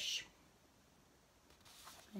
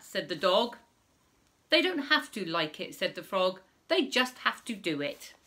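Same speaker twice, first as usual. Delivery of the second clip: put-on reading to children; 4 semitones higher, 7 dB louder than usual. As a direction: read it out big for little listeners. Reading rhyming story to children.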